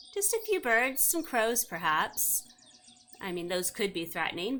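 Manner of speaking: ordinary delivery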